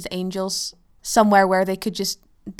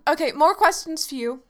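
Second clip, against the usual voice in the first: ordinary talk, nothing marked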